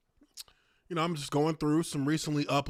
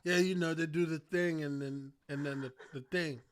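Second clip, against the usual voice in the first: half-hearted Mike; 2 semitones lower, 6 dB quieter than usual. Retold or imitated. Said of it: "half-heartedly into it".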